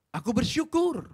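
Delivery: bersyukur cried out high and loud; loud proclamation. Shown as a demonstration. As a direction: proclaim bersyukur with full force.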